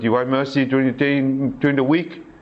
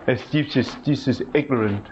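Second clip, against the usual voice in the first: ordinary delivery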